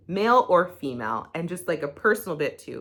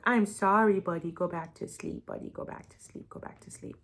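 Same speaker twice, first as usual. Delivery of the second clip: tender, fading softer down to a whisper; soothing the dog; 6 dB quieter than usual. Real, not acted. To her startled dog after slamming the notebook, tender, fading to a whisper. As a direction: hush the startled dog back to sleep.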